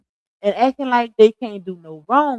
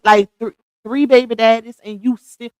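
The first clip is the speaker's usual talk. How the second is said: ordinary talk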